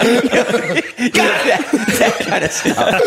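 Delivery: laughing hard, voice jumping up; can barely get it out for laughing; squealing laugh through the words; high laughter. Real, not acted.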